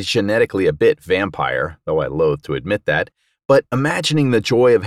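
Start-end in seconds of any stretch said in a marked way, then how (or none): none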